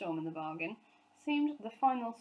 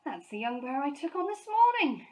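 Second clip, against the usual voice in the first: louder, higher amazed foreman; surprised exclamation; 7 semitones higher, 8 dB louder than usual. A narrator's reading. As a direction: blurt it in disbelief. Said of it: "exclaimed the foreman with surprise".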